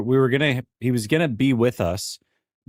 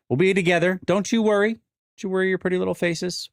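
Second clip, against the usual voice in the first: higher, sing-song cooing; a playful higher-pitched mock reassurance to the audience; mock sweet; 10 semitones higher than usual. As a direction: coo at the viewers like soothing toddlers. Teasing viewers in mock-sweet voice.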